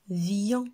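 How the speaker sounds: "vion" stretched as vii-yon; blended syllable. Shown as a demonstration. Teacher shows the resulting syllable, "vion" drawn out. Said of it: "la syllabe".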